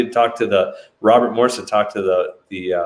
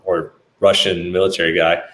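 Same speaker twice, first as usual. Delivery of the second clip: ordinary talk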